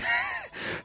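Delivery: squealing high laugh. Real, not acted.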